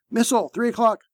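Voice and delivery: high, urgent call-out; called warning. A narrator's reading. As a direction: call the threat to the crew. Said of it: "someone called".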